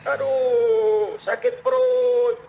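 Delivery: drawn-out, high moan of a bellyache; mock groaning. Preacher mimics a man Umar overhears at a door, groaning. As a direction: moan it slowly, hammed-up. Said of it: "mengerang, mengeluh, merintih".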